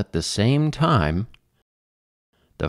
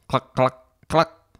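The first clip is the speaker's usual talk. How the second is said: higher, rising clucks; chicken clucking; 6 semitones higher, slower than usual. A narrator's reading. Chicken joining the farmyard chorus, clucking. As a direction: cluck like a hen. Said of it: "the chicken said".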